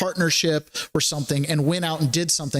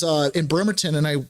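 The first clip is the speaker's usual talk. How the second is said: ordinary delivery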